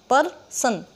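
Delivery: "Person" broken as पर, सन with a pause; split syllables. Shown as a demonstration. Teacher divides the word for students, "person" in two. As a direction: jerk out पर, pause, then सन in "person". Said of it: "बिल्कुल झटके पर, और हमने आराम से बोला".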